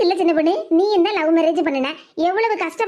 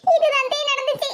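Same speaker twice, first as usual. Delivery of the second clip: high, acted crying voice; tearful complaint; 6 semitones higher than usual. Crying girl telling her aunt about mother's scolding, tearful. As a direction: complain through tears in a raised pitch. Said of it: "ஏன் மக்கா இப்படி அழுதுகிட்டு இருக்கா".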